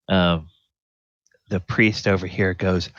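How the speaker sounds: ordinary delivery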